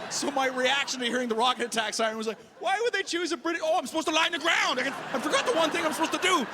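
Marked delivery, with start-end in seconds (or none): 2.6-6.4 high-pitched comic panic, words tumbling out; mock panic. Comic reliving his reaction to the siren, in high mock panic. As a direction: play up a flustered, high-voiced freak-out.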